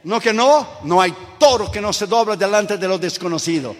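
normal talking voice

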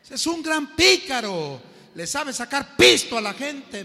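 loud, high gossiping jeer; a loud scoffing street kid; badmouthing stranger. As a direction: spit out the insult with relish.

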